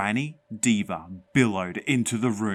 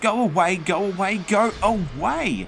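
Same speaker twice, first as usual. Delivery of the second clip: high, loud repeated scream; loud, high, raging; furious shriek; 11 semitones higher, 6 dB louder than usual. Acted. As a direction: drive the ghost out. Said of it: "I shrieked".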